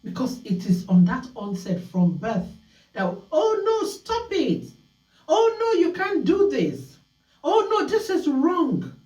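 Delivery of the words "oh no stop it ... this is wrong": high, exaggerated dismay at a naughty child; mock scolding parent. Imitating a parent scolding a child, high and shocked. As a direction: play a shocked parent catching the child misbehaving, pitched up.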